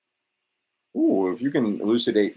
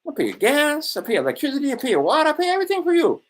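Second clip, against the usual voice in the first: very high, hammed-up sugary voice of a generous uncle; high and wheedling, like promising everything for free; Uncle Sam's offer; 13 semitones higher, faster than usual. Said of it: "He said".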